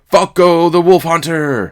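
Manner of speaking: loud, high, drawn-out cheer; acclaiming yell. A narrator's reading. A third drinker hails the hero, bellowing. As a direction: bellow the hero's title slowly. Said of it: "And another".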